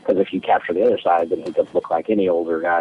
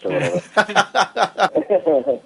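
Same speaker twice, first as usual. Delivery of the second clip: laughing; 12 semitones higher, much slower than usual. Pretend. Jokes about interrogator glove.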